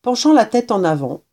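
plain reading voice

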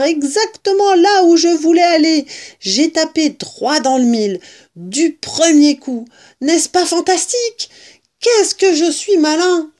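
high, loud, delighted boasting; elated, self-congratulating exclamations; gloating triumph. A narrator's reading. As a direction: crow over your own cleverness. Fox crows over his success.

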